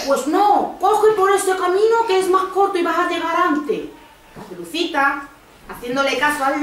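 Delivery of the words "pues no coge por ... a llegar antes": higher-pitched storybook wolf; lightly voiced scheming wolf; persuading wolf. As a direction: coax the girl onto the shortcut in a raised voice. Wolf talks Caperucita into shortcut.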